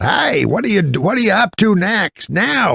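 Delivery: imitating an old star asking eagerly; Mickey Rooney impression. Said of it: "he said".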